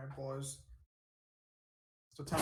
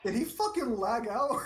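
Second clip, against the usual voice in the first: incredulous; 7 semitones higher, 4 dB louder than usual. Real, not acted. Debater goes silent.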